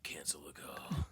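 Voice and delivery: mock stoic hard man; lightly voiced unflappable vet; gruff tough guy. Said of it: "just be like".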